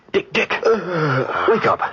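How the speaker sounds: urgent wake-up call. Acted. Urgently waking his sleeping partner.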